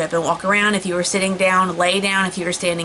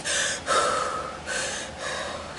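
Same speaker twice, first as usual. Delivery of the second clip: long soft exhaled sigh; long shaky sigh; 9 dB quieter than usual. Real, not acted. Pregnant woman in labor calming herself, long soft sigh. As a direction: breathe out slowly to steady yourself. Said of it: "trying to catch my breath".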